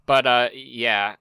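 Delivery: ordinary talk, nothing marked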